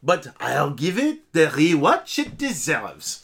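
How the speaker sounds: a light, put-on French accent. Co-host voicing a French listener's review in a light French accent. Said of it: "he is French".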